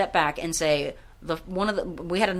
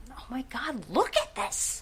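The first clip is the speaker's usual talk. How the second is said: hushed, breathless, much higher; awed gush; 6 semitones higher, 6 dB quieter than usual. Retold or imitated. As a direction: gasp it out in awe. Retelling his awe at food.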